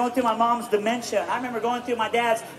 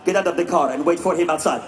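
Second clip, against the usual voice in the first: put-on lower, firm Iranian-accented father; a light impression of a stern immigrant dad; dad's Iranian accent; 5 semitones lower than usual. Father ordering his son out of the car, mild Iranian accent. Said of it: "and he goes".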